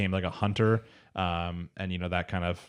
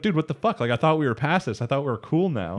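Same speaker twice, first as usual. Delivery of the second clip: higher pitched, louder indignant quote; mock outrage; 6 semitones higher, 3 dB louder than usual. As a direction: act out the friend's betrayed outrage. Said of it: "they're like".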